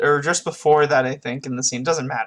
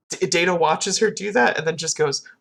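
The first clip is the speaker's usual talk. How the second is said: usual speaking voice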